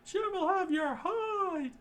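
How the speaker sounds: very high, slow, crying falsetto chef; shrill chef voice. Acted. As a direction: wail the warning in a squeaky falsetto.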